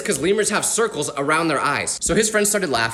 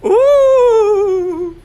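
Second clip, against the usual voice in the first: loud, high wailing ghost moan; a big high spooky ghost wail; 9 dB louder than usual. Acted. Ghost hamming up a loud, high spooky wail.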